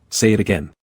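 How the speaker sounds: lightly teasing, a touch playful. A narrator's reading. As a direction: tease him into repeating it. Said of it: "said teasingly".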